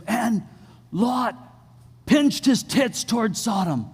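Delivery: high, ringing King James preacher voice; preaching the verse with high solemn fervor; preacher's blunder. Retold or imitated. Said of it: "the way he said it".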